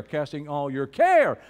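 big falling emphasis on "care"; stress on care. Shown as a demonstration. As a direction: save the force for "care". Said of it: "Say it with emphasis".

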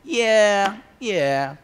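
loud, high mock agreement; dismissive agreement. Careless reader brushing off a verse, exaggerated dismissiveness. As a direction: brush it off with a big yeah.

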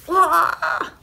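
loud, high leaping yell; startled cry. A narrator's reading. Farmer springing out of bed, crying out. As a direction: cry out while flinging yourself up.

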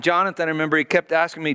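normal talking voice

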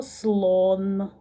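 "слон" as one long, level syllable; slow single-syllable "слон"; syllable demo. Shown as a demonstration. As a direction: draw "слон" out in one beat. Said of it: "промовте слово".

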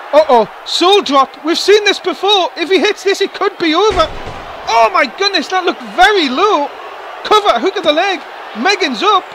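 high, loud fired-up play-by-play; wrestling commentator. Acted. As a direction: ride the drama of the near fall. Big move, commentator excited.

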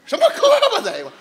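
fast, high, loud 胳膊 echo; indignant correction. Real, not acted. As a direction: spit out 什么胳膊 in protest. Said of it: "哥们儿。".

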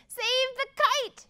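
very high-pitched cry; urgent shout. A narrator's reading. Mole calling to Bear in the storm, urgent shout. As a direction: cry out to rescue it. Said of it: "shouted Mole".

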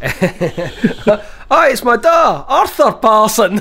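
giggling through the made-up name; laughing in character. Pretend. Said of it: "Do you know the donor?".

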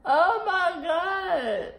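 slow, high, stunned oh my God; drawn out in disbelief; shocked. Real, not acted. Reacting to fish soup.

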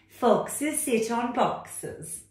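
loud, high, bossy cat voice. Acted. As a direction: order the frog about like a know-it-all cat. Cat character.

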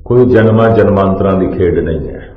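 ordinary talk, nothing marked

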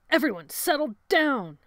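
loud, high, harsh order to settle; harsh command. A narrator's reading. Sebastian silencing the panicked boys, harsh command. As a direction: bark a harsh command over the clamour. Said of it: "Sebastian's harsh command silenced".

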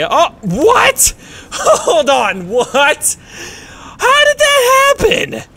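high, loud, incredulous outcry; baffled high-pitched yell; shocked disbelief. Real, not acted. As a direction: shriek it in stunned disbelief. Startled by sudden mishap in game.

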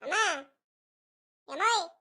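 calling round the house for family. Acted. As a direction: call out for someone out of sight. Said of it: "ಈ ಹುಡುಗ ಯಾಕಪ್ಪ ಹಿಂಗ್ ಕೂಕಡ್ತಿದ್ದಾನೆ".

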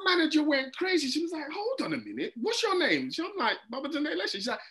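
high, excited retelling; animated manager story. Retold or imitated. Comedian retelling manager choosing the stage name, high and animated. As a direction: act out the manager taking charge, pitched high.

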